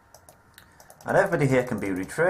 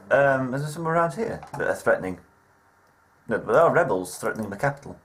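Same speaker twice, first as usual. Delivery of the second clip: usual speaking voice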